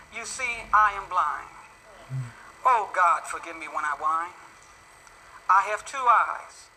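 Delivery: slow, low, heartfelt poem recital; poem reading. Acted. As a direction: read it gently like a devotional poem. Reads a poem aloud.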